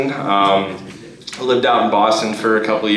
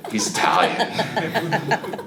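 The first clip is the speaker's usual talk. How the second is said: drawn-out verdict; 12 semitones higher, 5 dB quieter, much slower than usual. Retold or imitated.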